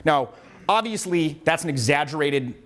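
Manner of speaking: ordinary delivery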